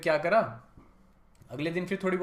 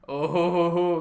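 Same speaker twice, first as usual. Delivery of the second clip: gloating chuckle; 4 semitones higher, 9 dB louder, much slower than usual. Real, not acted. Ex crying, narrator gloating.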